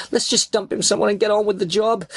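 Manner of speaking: high, urgent pleading yell; screaming. A narrator's reading. Driver begging his accomplices not to kill, screaming. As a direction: argue frantically at the top of your register. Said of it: "I screamed".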